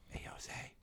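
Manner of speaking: hushed whisper to a companion. Pretend. Player furtively calls a party member aside, whispering. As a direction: get their attention under your breath. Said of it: "go up to Jose and whisper".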